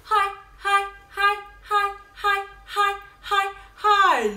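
high. Shown as a demonstration. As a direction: chirp the highs up top. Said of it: "your low voice".

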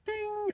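a very high sung ding; ping it out high like a bell; high bell ding after God's green light; imitated bell ding. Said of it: "I'm like".

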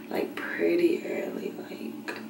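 hushed, trailing into a whisper. Real, not acted. Whispering late at night while filming her vlog. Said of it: "I'm whispering".

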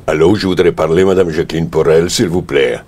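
put-on Russian accent, a bit loud; phone request in a mock-deep Russian voice. Caller asking for the casting lady, in a Russian accent. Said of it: "la voix la plus grave possible et un accent russe".

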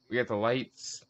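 normal talking voice

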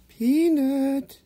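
high sing-song call to the dog; high-pitched dog call. Real, not acted. Calling the dog's name.

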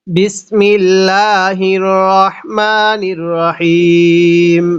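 drawn-out chanted Arabic, slow; measured reverent recitation; slow Quranic recitation. Shown as a demonstration. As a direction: intone it slowly like tilawat. Opening recitation of basmala.

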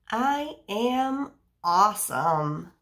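slow, loud and proud; proud read-aloud. Acted. Teacher reads the page proudly to children. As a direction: announce it proudly, slowly.

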